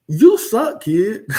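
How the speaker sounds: high, loud mock yell at a kid; blunt yell. Telling a struggling kid the hard truth, a loud mock yell. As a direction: bark the verdict at the child.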